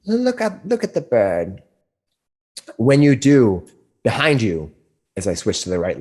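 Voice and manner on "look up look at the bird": put-on old crone voice. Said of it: "She says".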